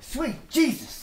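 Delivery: sudden high startled yelp; startled cry. Acted. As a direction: jump out of your skin. Soldier pops out of nowhere.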